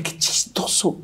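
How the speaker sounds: whispered, amazed aside; hushed amazement. Retold or imitated. Son whispering in amazement that the beach is Tuxpan. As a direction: breathe it out in wonder.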